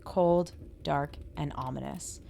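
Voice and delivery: story narration. Acted. Spooky story read at the top.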